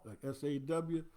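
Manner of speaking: ordinary talk, nothing marked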